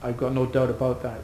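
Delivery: normal talking voice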